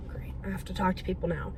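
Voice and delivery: a private sigh of dread, whispered; whispered aside. Retold or imitated. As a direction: whisper it to yourself, dreading small talk. Awkward inner thought aside.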